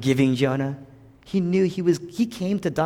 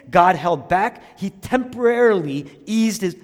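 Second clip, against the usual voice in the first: higher, louder fervent preaching; preaching at full force; 8 semitones higher, 6 dB louder than usual. Real, not acted. Preacher proclaiming to the congregation at full force. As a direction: drive it home to the room.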